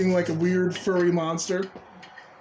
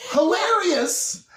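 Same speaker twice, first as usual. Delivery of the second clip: sarcastic; 11 semitones higher, 3 dB louder, much slower than usual. Acted. Mocking the review.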